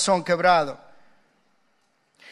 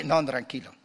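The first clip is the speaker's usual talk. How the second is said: ordinary delivery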